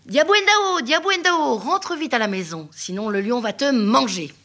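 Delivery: appel aigu et fort, qui porte loin; appel de la maman. Acted. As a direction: appelle ta fille depuis la maison. (la maman appelle sa fille au loin, voix aiguë et forte)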